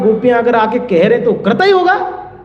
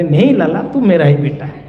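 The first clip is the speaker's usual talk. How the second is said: lower, gentle mother comforting her son; soothing mother; 7 semitones lower than usual. Acted. Yashoda gently reassuring her crying little son. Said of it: "Jasoda समझा रहे".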